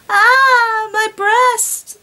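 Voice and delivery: high, loud hammed-up moan. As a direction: wail it like a bad melodrama.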